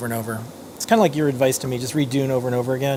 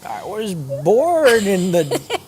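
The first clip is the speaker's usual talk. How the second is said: Bane impression; 4 semitones higher, much slower than usual. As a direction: drag it out like Bane. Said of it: "I'm like Bane".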